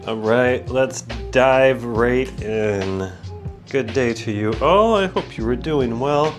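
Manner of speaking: ordinary talk, nothing marked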